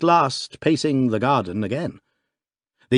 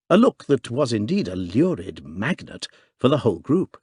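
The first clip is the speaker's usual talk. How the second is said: usual speaking voice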